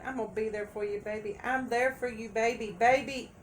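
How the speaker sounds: mimicking a smooth-talking partner, climbing high; mock sweet-talker. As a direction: croon empty promises to your baby. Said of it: "they sat there and told".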